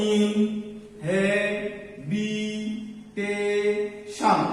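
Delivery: ইনহেবিটেশন extremely slowly, every syllable stretched on a level note; broken into syllables. Shown as a demonstration. Teacher asking students to look, ইনহেবিটেশন drawn out syllable by syllable. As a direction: draw out each piece of ইনহেবিটেশন, pausing between. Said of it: "ভেঙে ভেঙে উচ্চারণ করতে হবে".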